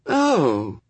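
high, falling, mildly let-down oh; a small drop of disappointment; slightly disappointed. A narrator's reading. Woman looks over the angel, slightly disappointed. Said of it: "in a slightly disappointed voice".

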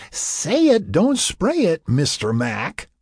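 high, singsong tipsy tease; playful teasing. A narrator's reading. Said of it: "she teased".

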